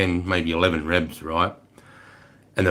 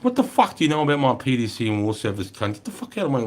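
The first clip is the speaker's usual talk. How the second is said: higher, heated mock yell; retold angry outburst; 6 semitones higher than usual. Veteran snapping at bikies about his PTSD, higher mock yell. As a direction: bite back at them, offended and drunk. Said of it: "I'm like".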